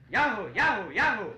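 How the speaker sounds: loud high yahoo calls, swooping; monkey shouting. Acted. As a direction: holler like a monkey in a shouting contest. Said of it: "the monkey lower down went".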